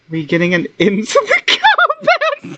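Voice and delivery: laughing. Real, not acted. Laughing mid-question at game.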